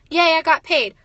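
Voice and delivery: a loud, bright little yay; mock payday cheer. Worker mocking the payday cycle, with a loud cheer. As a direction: celebrate the paycheck, tongue in cheek.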